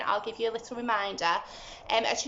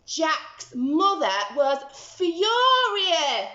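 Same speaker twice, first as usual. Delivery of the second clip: louder, higher, slow storytelling; furious narration; 6 semitones higher, 7 dB louder, much slower than usual. A narrator's reading. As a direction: lean into the anger. Narrator builds anger.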